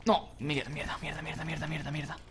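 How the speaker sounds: muttered soft curses; cursing under breath. Real, not acted. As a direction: swear quietly to yourself. Frustrated racing mistake, repeated curse.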